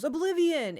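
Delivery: high, loud, proclaiming the title; excited announcement. Retold or imitated. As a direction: imitate a thrilled dad announcing the game. Dad announces game, acted out.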